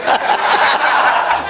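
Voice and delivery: laugh. Real, not acted. Preacher laughs at own joke.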